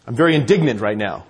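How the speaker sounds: low-pitched, mock-dignified indignation; classy indignation. Driver politely fuming on the road, mock classy indignation. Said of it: "You don't say really classy".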